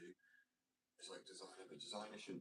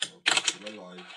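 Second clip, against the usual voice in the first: loud, dull shop-till greeting; bored cashier reading the welcome; depressed cashier; 16 dB louder than usual. Acted. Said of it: "I sound like a depressed cashier then".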